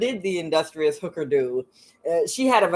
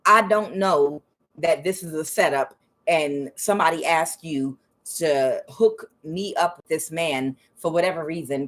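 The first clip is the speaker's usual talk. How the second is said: usual speaking voice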